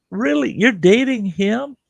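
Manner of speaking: very high, disbelieving question; incredulous. Retold or imitated. Storyteller questioning a friend about her new boyfriend, incredulous. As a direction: replay astonishment in a squeaky pitch.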